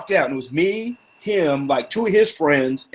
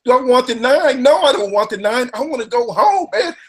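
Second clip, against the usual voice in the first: raised, incredulous no thanks; exasperated refusal; 8 semitones higher than usual. Retold or imitated. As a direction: act fed up and baffled. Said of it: "I go".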